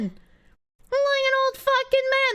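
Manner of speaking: high, slow drawn-out coo; gushing squeal. Real, not acted. To chat about a bearded cat, high slow coo. Repeats, higher and slower.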